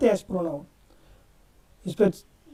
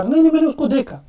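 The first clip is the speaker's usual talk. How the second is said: louder, higher नहीं, नहीं with a push on उसको; insistent denial; 5 semitones higher, 5 dB louder, faster than usual. Shown as a demonstration. Teacher demonstrating emphasis to students, louder and insistent. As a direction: protest that you really saw him. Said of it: "यहाँ पे मैं जोर लगा रहा".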